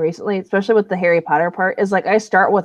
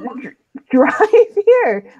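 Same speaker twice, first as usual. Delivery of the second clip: laughing; 7 semitones higher, 4 dB louder, much slower than usual. Real, not acted. Laughing demand.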